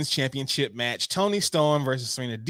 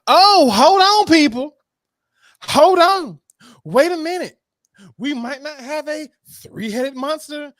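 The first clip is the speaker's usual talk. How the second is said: hype announcer; 10 semitones higher, 6 dB louder than usual. Acted. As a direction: announce the surprise entrant like a ring announcer. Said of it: "hyped ring announcer".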